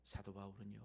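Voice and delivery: 사도 바울은요 dropped low and soft; hushed. Shown as a demonstration. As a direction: draw them in with a quiet low 바울은요. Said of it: "repeated phrase". (사도 바울은요를 낮고 조용하게)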